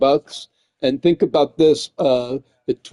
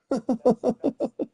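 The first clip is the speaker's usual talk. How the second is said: short laugh; 9 semitones higher, 8 dB quieter than usual. Real, not acted. Laughing about Arby's.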